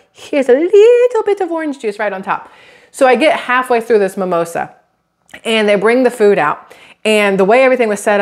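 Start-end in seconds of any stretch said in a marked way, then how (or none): 0.1-2.5 high mock server voice. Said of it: "They're like".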